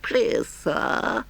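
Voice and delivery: high, pleading put-on voice; humble washerwoman. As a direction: wheedle politely as a meek washerwoman.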